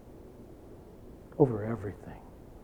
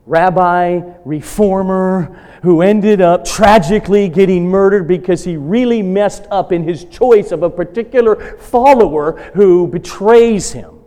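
forceful preaching; 6 semitones higher, 8 dB louder than usual. Acted. Pastor driving home God's sovereignty to the congregation, loud preaching. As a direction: proclaim God's control with rising force.

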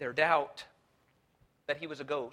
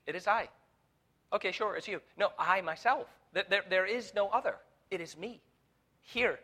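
higher, insistent, leaning on each claim; emphatic; 6 semitones higher, 4 dB louder than usual. Acted. Preacher voicing Jesus insisting to the disciples, emphatic. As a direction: insist it is really him. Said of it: "the emphatic usage".

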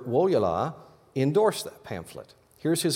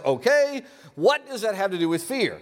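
puzzled dog voice; 9 semitones higher than usual. Pretend. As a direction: squeak it out as the husky. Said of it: "which of course translates as".

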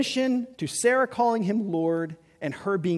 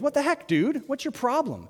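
higher, incredulous "what the heck"; exasperated Pharaoh; 8 semitones higher than usual. Retold or imitated. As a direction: play an annoyed king scolding. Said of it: "goes to Abraham and goes".

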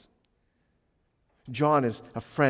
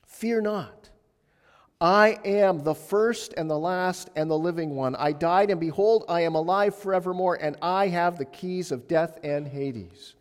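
raised, higher proclamation; proclaiming Jesus; 6 semitones higher, 5 dB louder than usual. Acted. Jesus announcing himself to John, raised and higher. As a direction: declare it with full authority. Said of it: "That's not what he says".

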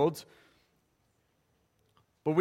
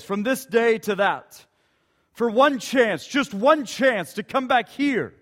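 loud impassioned battle speech; William Wallace speech; 3 dB louder than usual. Acted. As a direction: rouse them, builds to a loud close. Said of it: "William Wallace responds with this".

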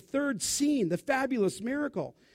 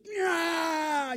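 demon shriek; 7 semitones higher than usual. Retold or imitated. Demon voiced shrieking as silenced.

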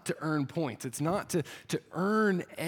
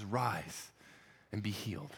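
tender care; 7 semitones lower, 9 dB quieter, slower than usual. Retold or imitated. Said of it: "you can hear the care and concern".

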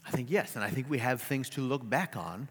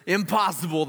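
louder, higher outcry; cried out; 6 semitones higher, 7 dB louder than usual. Acted. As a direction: reject it at once. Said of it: "the man cried".

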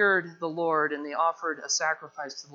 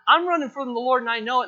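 loud, raised admission; emphatic confession; 6 semitones higher, 6 dB louder, faster than usual. Acted. Jonah owning up to sailors, emphatic confession. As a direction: blurt it out emphatically.

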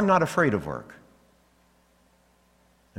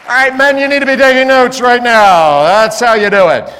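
full-force preacher shout, much higher and louder; 12 semitones higher, 17 dB louder than usual. Real, not acted. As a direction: roar it at the men in the pews.

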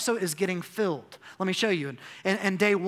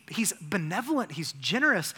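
ordinary talk, nothing marked

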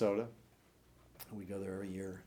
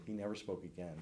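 normal talking voice

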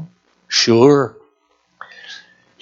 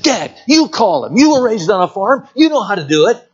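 put-on pleading kids; 6 semitones higher than usual. Retold children urging their dad.

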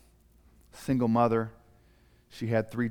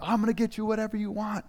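excited mom; 10 semitones higher, faster than usual. Acted. Mother promises the kids.

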